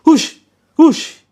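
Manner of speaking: high, loud shout of hush; sharp loud shoo. Shown as a demonstration. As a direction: bark it like chasing chickens. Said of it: "Nggak gitu kan".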